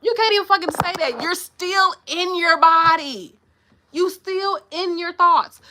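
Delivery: high, loud, drawn-out insistence; worked-up preachy holler; emphatic exasperation. Real, not acted. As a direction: drive it home, stretching the key words. Worked up driving the point home.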